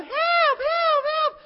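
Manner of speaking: high, loud, frantic yell for help; hammed-up cry of help from the monkey bars; kids shouting for help. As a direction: scream for help like a kid in peril. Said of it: "So we were yelling".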